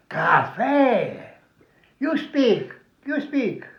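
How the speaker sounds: mock the Frenchman's surprise; lightly voiced Frenchman amazed; surprised Frenchman.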